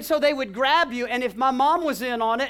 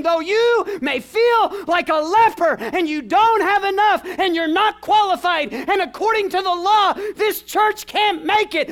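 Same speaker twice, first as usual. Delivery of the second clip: shouted voice of God; 7 semitones higher, 7 dB louder than usual. Acted. Preacher voicing God to the congregation, booming shout. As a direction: boom it out as God's voice.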